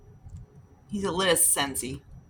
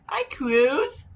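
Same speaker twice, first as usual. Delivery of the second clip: much higher, louder coo at the cat; cat greeting; 10 semitones higher, 6 dB louder than usual. Retold or imitated.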